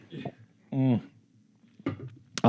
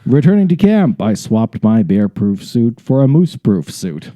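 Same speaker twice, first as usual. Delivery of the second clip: dramatic story narration; slower than usual. Acted. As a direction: read it out with mock-heroic gusto. Resumes story with gusto.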